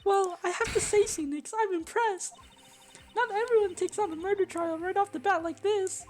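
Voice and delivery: high, loud squeaky boss voice; high falsetto character voice. Pretend. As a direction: squeak it out like a cartoon chief. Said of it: "Do I have to do this the whole time?".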